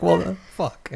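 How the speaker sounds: high incredulous well the fuck; stunned disbelief. Retold or imitated. Walking outside, hearing the twist, high stunned disbelief. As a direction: say it in shocked disbelief. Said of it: "I was like".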